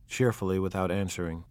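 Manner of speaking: ordinary delivery, nothing marked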